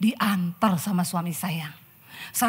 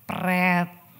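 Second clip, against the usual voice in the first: dismissive raspberry; 3 semitones lower, 3 dB louder than usual. Acted. Wife dismissing husband's text.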